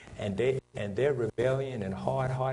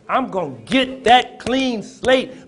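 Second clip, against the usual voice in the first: much louder and higher, slow and drawn out; loud preaching cry; 8 semitones higher, 14 dB louder, slower than usual. Shown as a demonstration. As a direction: shout the promise like a climax. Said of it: "Somebody say".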